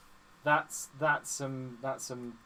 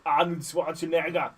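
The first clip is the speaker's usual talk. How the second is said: louder mock Austrian accent; Arnold Schwarzenegger; 5 dB louder than usual. Co-host demonstrates the accent, mock Austrian. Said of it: "trying to do Arnold Schwarzenegger".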